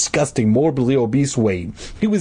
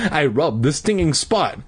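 ordinary delivery, nothing marked

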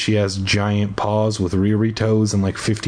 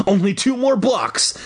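high call, only mildly breathless; calling breathlessly; 9 semitones higher than usual. A narrator's reading. Kid calling to his brother while pedaling, mildly breathless call. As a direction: call ahead in a raised pitch. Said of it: "I called breathlessly".

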